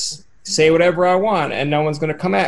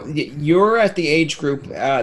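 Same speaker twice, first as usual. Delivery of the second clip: usual speaking voice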